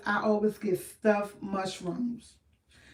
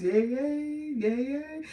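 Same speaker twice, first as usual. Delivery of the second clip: cheering; 5 semitones higher, much slower than usual. Real, not acted. Cheering April birthdays.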